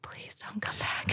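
soft, voiceless begging; whispered plea. Retold or imitated. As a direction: whisper a plea to yourself, curled up. Re-enacts pleading alone in tent.

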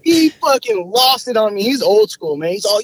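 ordinary talk, nothing marked

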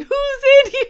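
high, loud, hammed-up Irish-pub ghost hunter yell; mock demanding yell. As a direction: bang on the stalls and call out, overdone.

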